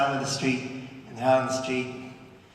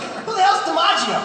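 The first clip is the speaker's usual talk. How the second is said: high-pitched mock bafflement; put-on squeaky indignant question; clueless child, imitated; 13 semitones higher than usual. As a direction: mimic a baffled kid in a much higher voice. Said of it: "She was also like".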